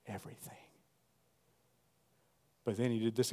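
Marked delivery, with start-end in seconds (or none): 0.1-0.6 breathy whisper. Acted. Preacher whispering promise to congregation, whispered. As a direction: breathe the word out.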